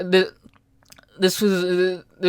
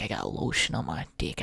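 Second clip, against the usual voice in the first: low murmur; 12 dB quieter than usual. Acted. Repeated meme line, quieter.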